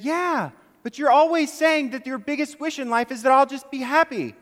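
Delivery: spring the trick with glee; cheeky little boy. Acted. Son gleefully reveals to mom the surprise trick.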